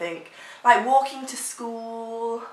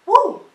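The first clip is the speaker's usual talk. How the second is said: excited whoop; 14 semitones higher, 11 dB louder than usual. Real, not acted. Whoops after a challenge.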